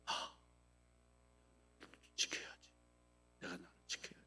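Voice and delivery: breathy whisper to yourself, no voice at all; a private whispered reminder to hold back; whispered self-talk. Pretend. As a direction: murmur the resolve under your breath. Inner self-talk acted.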